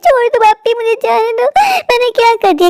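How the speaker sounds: very high, wailing plea; distressed pleading. Acted. Stepsister begs to be released, distressed pleading. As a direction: cry out for release, helpless.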